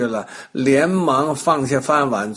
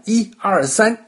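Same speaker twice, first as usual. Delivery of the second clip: raised, higher counting voice; counting out a start; 8 semitones higher than usual. A narrator's reading. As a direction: call out a race countdown. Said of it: "叫完".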